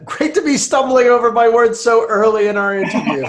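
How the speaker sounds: laughing through the words. Real, not acted. Guest laughing at himself for tripping over words. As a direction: chuckle at his own stumbling.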